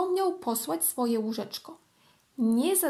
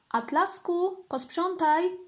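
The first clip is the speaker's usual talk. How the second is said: higher calling mother's voice; 6 semitones higher, slower than usual. Acted. Mother calls her son to tidy, raised and higher. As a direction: call up to him like a mum. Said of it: "Mama wołała".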